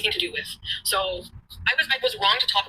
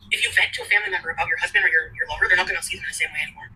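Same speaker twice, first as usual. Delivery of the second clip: ordinary talk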